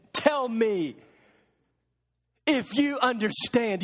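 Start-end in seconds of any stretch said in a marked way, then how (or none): none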